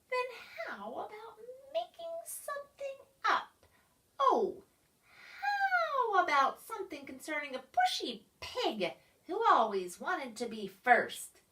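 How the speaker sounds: a hint of a sly creature; lightly teasing creature. A narrator's reading. Creature teases the pushy pig, lightly sly. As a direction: suggest it slyly.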